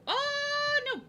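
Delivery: high, loud drawn-out "oh", then a quick "no"; dramatic oh no. Real, not acted. Artist cries out over going too far on a drawing, dramatic high wail.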